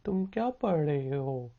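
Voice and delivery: ordinary talk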